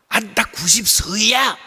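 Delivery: loud, drawn-out acted boast; boastful old man. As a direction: announce it slowly and loudly, showing off. Acts old man's proud retort.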